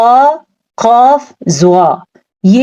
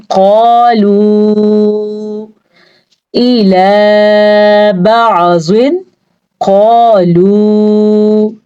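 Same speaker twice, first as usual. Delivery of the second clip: slow, drawn-out tajweed chanting; Quranic recitation; much slower than usual. Shown as a demonstration. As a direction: recite slowly, stretching each vowel. Recites the verse. (धीमी तिलावत)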